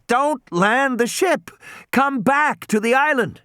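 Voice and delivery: put-on high, slow, deliberate boy's warning; insistent high-pitched young caller; urgent radio warning. A narrator's reading. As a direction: space the words out so a faint radio gets them. Boy repeats warning over radio.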